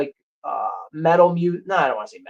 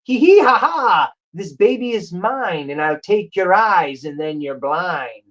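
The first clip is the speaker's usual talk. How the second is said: higher-pitched manic villain cackle into gloating threat; manic jester; 3 semitones higher than usual. Retold or imitated. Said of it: "He talks in this manic way".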